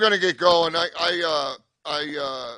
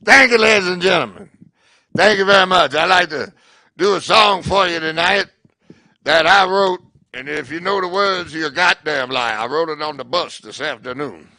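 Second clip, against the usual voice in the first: put-on loud, higher old bluesman voice; 3 semitones higher, 10 dB louder than usual. Old blues master thanking the club crowd, gravelly bluesman impression. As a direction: play the ancient blues master greeting the crowd. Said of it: "old man comes out".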